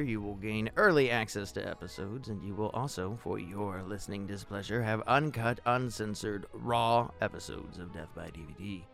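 ordinary talk, nothing marked

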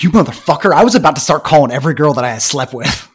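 loud, high, furious mock yell of disbelief; retold outburst. Medic imitating his buddy losing it over the prank, loud mock yell. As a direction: burst out cursing at the buddy who pranked him. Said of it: "He, like, lost it".

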